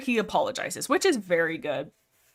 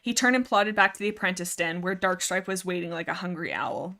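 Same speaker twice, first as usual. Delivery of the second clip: ordinary talk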